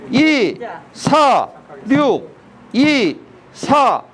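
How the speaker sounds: slow, spaced-out dance count; counting out beats. Shown as a demonstration. Dance teacher counts 이사륙 for the class, slow measured count. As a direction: count each beat singly, with long gaps.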